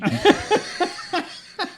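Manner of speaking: high put-on cabbie laugh in Italian accent; imitating the cabbie's high laugh. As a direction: laugh big like the corny driver.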